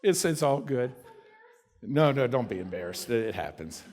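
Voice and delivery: calm an embarrassed person; reassuring. Real, not acted. Preacher gently reassuring an embarrassed listener.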